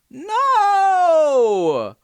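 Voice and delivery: loud, high exasperated shout of no. Real, not acted. Shouting at his friend's wrong guess, exasperated. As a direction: yell it, fed up with the wrong guesses.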